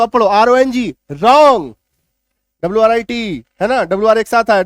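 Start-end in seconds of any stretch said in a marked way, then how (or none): 1.1-1.7 "रॉन्ग" louder, no w, rɔŋ; silent w. Shown as a demonstration. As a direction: hit "रॉन्ग" starting on r. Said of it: "डब्ल्यू काट दो". ("रॉन्ग" ज़ोर से, बिना डब्ल्यू के)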